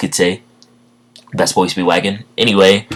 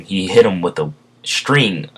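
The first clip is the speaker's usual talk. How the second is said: ordinary delivery, nothing marked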